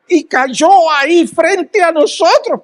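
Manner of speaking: amazed telling. Retold or imitated. Climax of the cat story.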